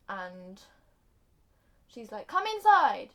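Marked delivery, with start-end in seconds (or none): 2.3-3.1 high, loud beckoning call. Acted. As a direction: call her in from outside. Character calls another inside.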